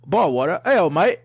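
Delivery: loud, high, hammed-up British accent; bad British veering into Australian; attempted British accent. Shown as a demonstration. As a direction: belt out a fake British accent. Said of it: "in a British accent".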